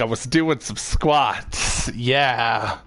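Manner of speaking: drawn out, deliberate and sheepish; slow awkward excuse. Retold or imitated. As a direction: say it slowly, committing to the lie. Repeats excuse as chosen.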